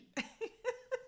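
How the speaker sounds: real squeal of laughter; high laughing squeal. Real, not acted. Comic recalling the heckler's husband, high burst of laughter. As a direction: crack up at the memory.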